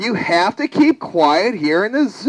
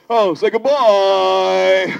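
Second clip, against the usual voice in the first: slow, stretched farewell prompt; calling out; slower than usual. Acted. Asking the audience to bid goodbye, drawn-out call. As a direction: hold the last word long.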